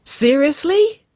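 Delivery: very high, loud, stretched disbelief; gasped. A narrator's reading. Sister hearing surprising date news, gasped in astonishment. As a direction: rise sharply in amazed question. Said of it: "Wynona gasped".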